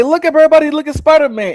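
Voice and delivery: high, loud mock announcement; excited crowd call. Voicing onlookers spotting Spider-Man at the hospital, excited shout. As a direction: call it out high, pointing him out to everyone.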